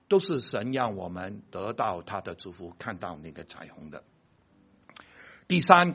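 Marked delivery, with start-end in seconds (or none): 0.1-4.0 much softer and lower; hushed. Real, not acted. Preacher closing his point in a hushed voice. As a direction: let it sink in quietly.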